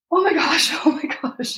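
high, giggling oh my gosh; laughing disbelief. Retold or imitated. As nurses cut her shirt, high laughing disbelief. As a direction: laugh through the mock shock.

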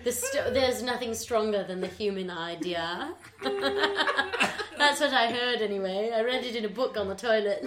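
hammed-up British accent, laughing; pompous mock-British storyteller breaking into laughs. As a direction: put on a grand British voice. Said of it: "speaks exclusively in British accents".

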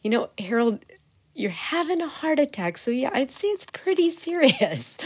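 lightly voiced doctor, amused and matter-of-fact; mock doctor telling the patient the obvious; doctor quoted.

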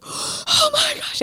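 high, very slow breathy-free gasp of wonder; awed gasp. Retold or imitated. Retelling first prayer language to host, stunned. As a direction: let the awe lift it way up, drawn out. Retold moment of awe.

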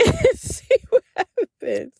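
words breaking up into laughter; amused, laughing through the words. Real, not acted. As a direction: crack up while finishing the sentence. Cracks up mid-sentence.

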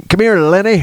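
high, teasing call to a friend; mock Lenny call. Son mock-calling his dad as Lenny, high and teasing. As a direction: sing it out like calling Lenny over. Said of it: "He wanted me to".

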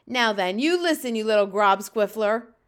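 loud bullying giant; booming. A narrator's reading. Bully giant confronting the smaller giant, booming. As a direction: boom out a threatening order. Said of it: "boomed the fresh flesh lump eater".

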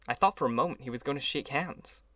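ordinary delivery

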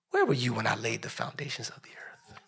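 low breathless whisper; awed whisper. Acted. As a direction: whisper God's question to Job. God's question to Job whispered.

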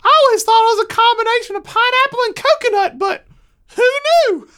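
put-on high, loud astonished kid; squeaky child voice, full volume; young son's voice. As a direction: exclaim like an excited little boy. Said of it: "he responds, he goes".

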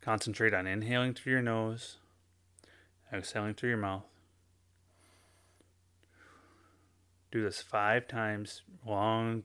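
soft, slowed guided breathing instructions; gentle meditation guide voice. Acted. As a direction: ease them into calm, quietly and slowly. Leads a breathing exercise.

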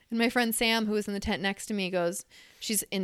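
ordinary talk, nothing marked

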